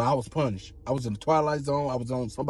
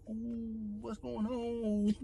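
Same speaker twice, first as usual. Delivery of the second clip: soft, slow, high spooked ooh; scared whisper; 8 semitones higher, 7 dB quieter, much slower than usual. Retold or imitated. Him at the jumping computer screen, soft slow spooked. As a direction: hush it like you're spooked.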